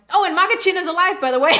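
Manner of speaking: thrilled shout of relief; excited. Real, not acted. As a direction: blurt the good news out. Excited news about the dog.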